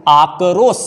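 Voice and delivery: "आक्रोश" broken up as aa-ka-ros; mispronunciation demo. Shown as a demonstration. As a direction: put an extra a between k and r in "आक्रोश", end on s. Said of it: "ये भी गलत है".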